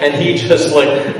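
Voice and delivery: ordinary talk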